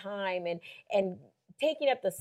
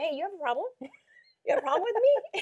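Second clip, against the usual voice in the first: mock picking a fight; confrontational; 9 semitones higher, faster than usual. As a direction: call someone out, voice rising at the end. Retold confrontation.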